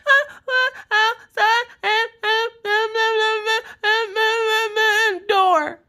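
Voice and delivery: high mock sobs with garbled middle; sobbing mumble rapper. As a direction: bawl it unintelligibly, imitating an upset child. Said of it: "you turned into a mumble rapper".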